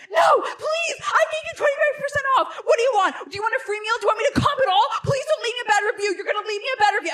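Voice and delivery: high, loud desperate begging; pleading. Retold or imitated. Owner chasing the car, begging high and loud. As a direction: beg them not to review. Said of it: "he's like".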